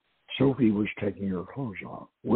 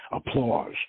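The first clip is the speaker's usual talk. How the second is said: plain reading voice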